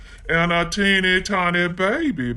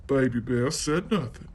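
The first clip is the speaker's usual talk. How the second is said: plain reading voice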